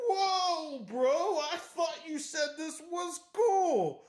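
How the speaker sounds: high-pitched, baffled bro protest; hammed-up indignant surprise; mock startled protest. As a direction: imitate a guy caught off guard. Acts startled friend protesting.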